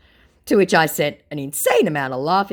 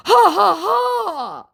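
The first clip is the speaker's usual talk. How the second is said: high, loud, spelled-out ha-ha; mock scammer laugh; 9 dB louder, much slower than usual. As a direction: fake the scammer's laugh loudly. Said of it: "says my sca-, uh, my scammer".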